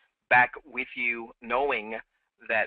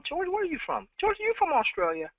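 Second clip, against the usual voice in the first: much higher, calling out to George in the chat; pitched up high, hailing a viewer by name; calling out a question. Real, not acted. Host calls out to a viewer whose location is unclear, pitched high and bright.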